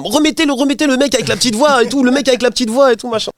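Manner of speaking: high-pitched begging child, reenacted; pestering kid. Child pestering the older kids.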